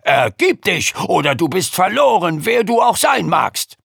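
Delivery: loud, rising menacing shout; threatening shout. Acted. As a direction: roar the threat at the hidden foe. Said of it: "so laut und drohend er konnte".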